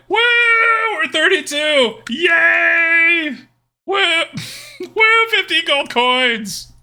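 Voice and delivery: high, drawn-out whooping celebration; excited cheer. Real, not acted. Streamer celebrating a win with viewers, excited cheering. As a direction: whoop and hold the cheers long.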